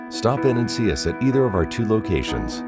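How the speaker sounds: normal talking voice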